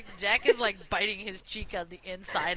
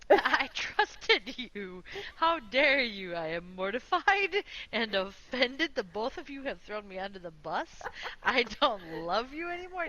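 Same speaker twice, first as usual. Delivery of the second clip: slow, hammed-up indignation; mock betrayal. [name] to friends who threw him under the bus, mock-offended. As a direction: draw out the wounded accusations theatrically.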